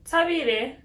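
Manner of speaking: loud call across the house; calling out a name. Real, not acted. Calling to Thabile in another room, loud call.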